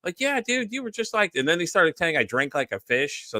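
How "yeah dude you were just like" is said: high-pitched mock buddy voice; imitated friend. Friend recounting the drunken night, high-pitched imitation. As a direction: jump up high to play the friend.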